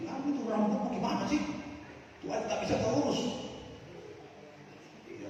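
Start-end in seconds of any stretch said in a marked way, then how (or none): 0.1-3.5 mock-scolding husband, higher pitched; hammed-up husband scolding his wife over her hair; nagging husband. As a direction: play the petty husband griping at his wife. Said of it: "Jangan marah-marah sama istri".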